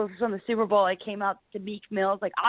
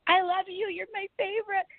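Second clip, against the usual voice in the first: put-on much higher, gushing girlish fan voice; gushing young fan; 12 semitones higher than usual. A cute blonde fan gushing to Warren Sapp, high and girlish. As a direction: squeal it like a starstruck fan. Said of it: "this little cute blonde girl".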